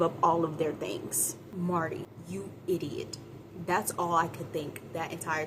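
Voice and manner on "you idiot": ordinary delivery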